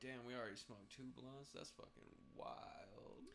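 softly stunned, fading to a whisper; hushed disbelief. Retold or imitated. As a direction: murmur it in slow amazement. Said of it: "I was like".

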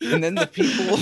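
laughing. Real, not acted. Host losing his closing thought to giggles, laughing. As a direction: try to get the words out through real laughter.

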